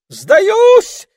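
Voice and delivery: very high, loud terrified squeal. A narrator's reading. Frightened ermine surrendering, shrill terrified squeal. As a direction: shriek in panic. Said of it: "провизжал он".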